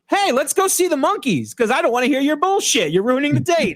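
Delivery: high-pitched, hammed-up impatient outburst; brash interruption. Paquita cuts Lionel's sad story short, brashly mock-impatient. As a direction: cut in loudly cheerful, mock-exasperated. Acting out Paquita cutting him off.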